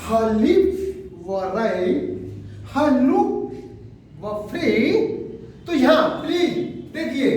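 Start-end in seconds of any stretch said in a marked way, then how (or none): 0.1-4.8 very slow, leaning on lips and look; sing-song metrical recitation, lips held long; teacher chanting the line to show its meter; rhythmic stressed reading. Shown as a demonstration. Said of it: "Try again".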